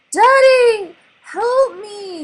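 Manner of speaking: very high, very loud scream; terrified cry for help. A narrator's reading. Trapped son screaming to father, very high panicked cry. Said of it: "Nemo shouted".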